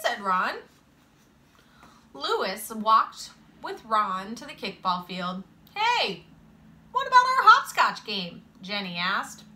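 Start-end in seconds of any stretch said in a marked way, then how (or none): 5.7-8.4 high, loud objection; protesting girl. A narrator's reading. Girl calling after friend who leaves their game, high protest. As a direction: call out in high-pitched protest.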